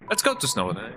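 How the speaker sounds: ordinary delivery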